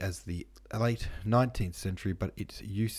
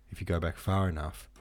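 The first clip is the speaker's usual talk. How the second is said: ordinary talk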